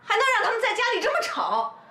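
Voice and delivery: high-pitched indignant outburst; indignant insistence. Acted. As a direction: push past them, voice pitched high. 婆婆冲去制止吵架. (气冲冲地尖起嗓门)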